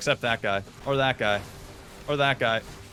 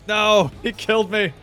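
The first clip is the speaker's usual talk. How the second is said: dismayed cry; 8 semitones higher, 7 dB louder than usual. Real, not acted. Killed by boss.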